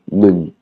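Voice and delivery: "nung" with a short falling tone. Shown as a demonstration. Teacher closing the explanation of the falling tone on "nung". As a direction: drop "nung" down at the end. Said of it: "une intonation qui descend".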